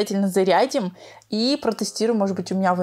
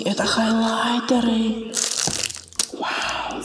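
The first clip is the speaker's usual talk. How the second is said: whispering; 2 semitones higher, slower than usual. Real, not acted. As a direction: whisper in awe. Whispering over glitter.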